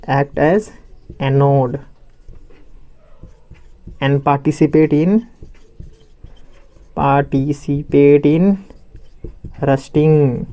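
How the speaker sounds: words stretched out very slowly; slow dictation. Real, not acted. Teacher dictating notes to students, slow dictation. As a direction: draw out each syllable as if writing along.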